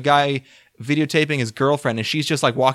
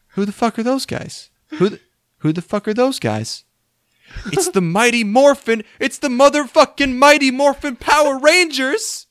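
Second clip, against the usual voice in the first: mock amazed outburst; 11 semitones higher, 3 dB louder than usual. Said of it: "at one point he's like".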